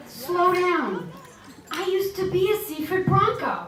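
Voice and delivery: high, playful mock protest; a lightly hammed-up sassy retort; sassy comeback. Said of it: "I said".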